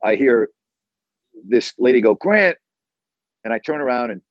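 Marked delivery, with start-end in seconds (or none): 2.1-2.6 high-pitched imitation of a woman calling out; retold call-out. Lady at the gas station spots the host, imitated high call-out. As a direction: imitate her calling across the shop. Woman calls out his name in store.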